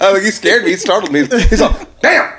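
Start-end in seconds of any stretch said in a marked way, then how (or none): 2.0-2.3 high, sudden "damn"; imitated yell. Stranger coming up behind him, imitated high yell. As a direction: imitate a startling outburst, pitched way up. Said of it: "He, he yelled pretty loud".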